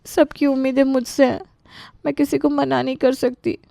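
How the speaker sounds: high, strained crying voice; tearful. Acted. Exhausted Anita explains she can't refuse anyone, tearful. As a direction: confess helplessly, close to tears. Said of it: "वो दर्द के मारे रोने लगी".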